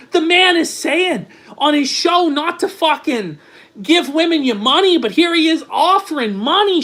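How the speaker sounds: high, strained frantic yelling; screaming impression. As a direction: rant at Sean in a shrill mock meltdown. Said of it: "I gotta stop doing that impression".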